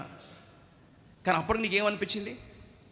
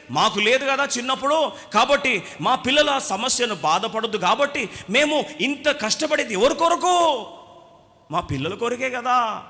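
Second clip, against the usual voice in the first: louder, higher doting parent pleading his case; indulgent parent; 6 semitones higher, 6 dB louder than usual. Retold or imitated. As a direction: parents insisting it is all for their children. Parents justify spoiling children.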